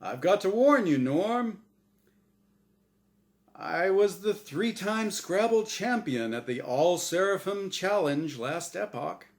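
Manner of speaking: booming, bright host voice; game show host. Acted. The angel Azrael hosting his show for Norm, big host voice. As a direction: sell it like a TV quiz host.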